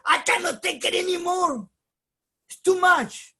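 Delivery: high, loud cry of giving up; exasperated outburst. Pretend. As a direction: burst out at the end of your rope. Exasperated quote of frustrated person.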